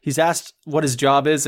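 usual speaking voice

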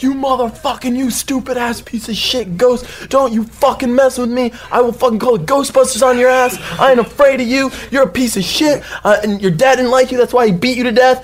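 high, loud ranting tirade; re-enacted furious, high-pitched rant; going mental. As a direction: rage at a ghost without stopping. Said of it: "I just go mental".

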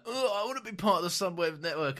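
high, whiny mock Rose; eager Rose. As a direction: beg to be included, childishly. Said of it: "Rose is like".

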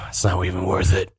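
sighed, low resignation; sigh, then give up on it; fed-up employee. Acted. Half-orc worker abandoning the chase, low and resigned. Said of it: "He's like".

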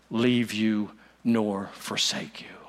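hushed to a whisper, slow; whispered, slow and intimate; whispered promise. Acted. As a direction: let it fall to a breathless whisper. Whispered climax of the Greek reading.

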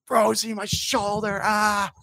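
high, slow, strained plea ending in a cry; begging an opponent to let up, high pained cry; retold pained scream. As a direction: cry out desperately for him to stop, voice pitched high. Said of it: "screaming at you".

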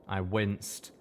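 usual speaking voice

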